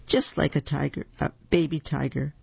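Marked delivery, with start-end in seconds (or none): none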